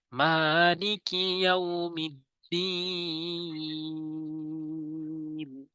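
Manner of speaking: slow, drawn-out chanted recitation; Quran recitation. Shown as a demonstration. Teacher recites the verse for viewers, slow and chanted. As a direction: stretch each long vowel like tajweed. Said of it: "মিমে টান দিতে হবে".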